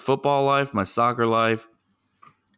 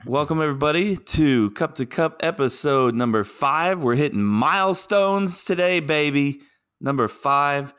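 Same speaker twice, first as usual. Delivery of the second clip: podcast intro voice; 4 semitones higher than usual. Acted. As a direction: announce the episode like a host. Gives the show intro.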